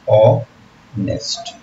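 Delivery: ऑनेस्ट said slowly with a silent h, starting on "ऑ"; teacher slowly models ऑनेस्ट with no h, starting on "ऑ"; slow pronunciation demo. Shown as a demonstration. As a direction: draw out ऑनेस्ट with no h sound, opening on "ऑ". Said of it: "क्या बोलते हैं?".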